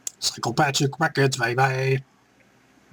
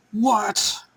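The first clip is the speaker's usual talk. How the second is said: surprised what; 10 semitones higher than usual. Real, not acted. Surprised at Stephanie's comeback.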